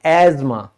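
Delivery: एजमा with a z sound, no th; silent th in asthma. Shown as a demonstration. Teacher gives the English way to say asthma. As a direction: say एजमा, th dropped. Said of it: "क्या कहेंगे".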